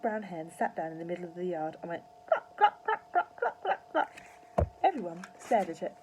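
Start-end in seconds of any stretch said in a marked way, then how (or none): 2.6-4.1 high, loud clucks; high imitated hen cackle; hen clucking. A narrator's reading.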